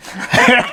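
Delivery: a real high laugh. Real, not acted. Laughing at dad's answer.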